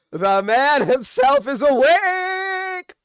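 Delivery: high, loud, drawn-out mock fanfare; big theatrical reveal, last word held long; hammed-up announcement. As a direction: stretch it out like a ring announcer. Mock dramatic announcement of villain's return.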